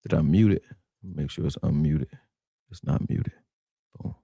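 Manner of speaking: whispering while checking the mute; whispered aside. Real, not acted. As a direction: mutter it under your breath. Checking stream mute to himself.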